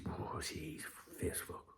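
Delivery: slow hushed whisper; slow confiding whisper; whispered aside. Real, not acted. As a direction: lean in and whisper it. Confiding about girlfriend.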